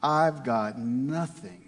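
low, drawn-out mild grumble; grumbling. Acted. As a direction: grumble it slowly, sinking away to almost nothing. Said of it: "and grumbled".

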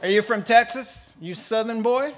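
loud, high mock interrogation; challenging accent tester. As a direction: size up the suspect for laughs. Acted interrogation of a Texan.

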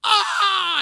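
very loud, high-pitched yell; imitated brother's scream. Brother reacting to the curse at bedside, loud scream. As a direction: burst out with a high mock scream. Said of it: "my brother goes".

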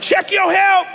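loud high shout. Real, not acted. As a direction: belt it out high. Preacher shouting exhortation.

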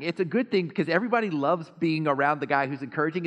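usual speaking voice

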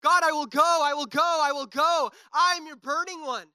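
high, straining declaration; fervent high worship cry; retold scream. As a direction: fists clenched, shouting it out at a high pitch. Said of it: "I legit remember screaming".

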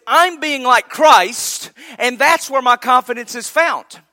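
preacher hitting the climax of his point; loud emphatic quote. Retold or imitated. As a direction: proclaim it slowly, building to a peak. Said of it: "he says".

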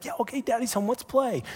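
put-on high, eager little girl voice; excited toddler. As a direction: play the thrilled three-year-old greeting daddy. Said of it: "she's so excited to be like".

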